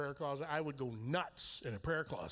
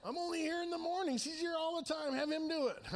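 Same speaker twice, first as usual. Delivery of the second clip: put-on much higher, childish whining plea; high whiny bargaining with God; whiny complaint to God; 11 semitones higher than usual. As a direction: whine like a kid dodging a chore. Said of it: "I'm like".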